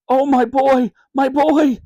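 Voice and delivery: high, loud overjoyed cry; a father hugging his lost son; relieved. Acted. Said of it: "said Dad".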